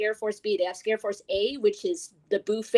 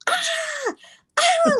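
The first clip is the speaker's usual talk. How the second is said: high-pitched mock scream; imitated scream. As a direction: mimic a man's terrified shriek. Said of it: "you could tell he was screaming".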